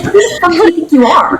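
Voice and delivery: mock indignant. Girlfriend to boyfriend about to flee, mock outrage. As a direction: scold him like he's lost his mind. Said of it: "I said".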